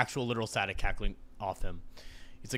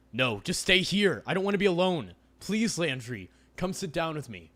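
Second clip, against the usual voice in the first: pleading; 5 semitones higher, 5 dB louder than usual. Acted. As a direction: beg him gently. Avery pleads not to be alone.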